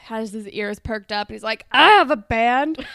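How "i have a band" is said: lightly voiced moody teen boy; a hint of a brooding guy, louder; Craig voice. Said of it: "That's a great Craig".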